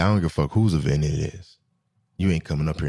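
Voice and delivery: low, blunt imitation of the old security guard; gruff old guard. Old guard blocking him from the section, low and blunt. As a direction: growl him off like a tired old bouncer.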